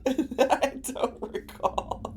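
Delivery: slow, high, breaking into laughter; laughing. Real, not acted. Streamer not remembering the reference, laughing. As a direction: let the words dissolve into a laugh.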